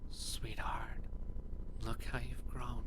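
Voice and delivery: tender whispered mother's voice; a loving mother, breathed like a ghost; whispering ghost mother. Acted. Mother's ghost speaks to hero.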